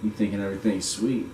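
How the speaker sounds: usual speaking voice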